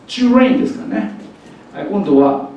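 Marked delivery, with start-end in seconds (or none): 0.1-0.6 trainを「チュレイン」と高めに発音; 「チュレイン」の頭をチュで始める; チュに変わるT. Shown as a demonstration. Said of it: "Tの音を超えちゃってます". (英語式の「チュレイン」)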